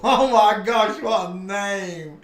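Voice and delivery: real delighted laughter at a long name; laughing disbelief. Real, not acted.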